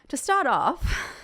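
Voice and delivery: slow, high attempt at a British accent. Shown as a demonstration. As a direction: try one line in a British accent. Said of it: "Should I do it with a British accent?".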